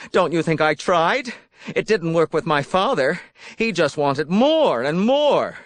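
high, agitated self-pitying outburst; voice rising in pitch. A narrator's reading. Ed complaining about his parents to Beth, voice risen and agitated. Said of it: "His voice rose a note".

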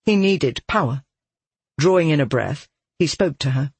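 usual speaking voice

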